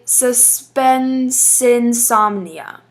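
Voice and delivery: "suspensinsomnia" run together as one word, slowly; slow, joined-up "suspensinsomnia" showing the elision; elided pronunciation. Shown as a demonstration. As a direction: draw out "suspensinsomnia" with no break between the words. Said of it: "if you were to be saying this".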